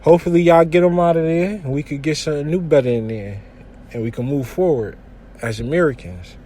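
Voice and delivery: usual speaking voice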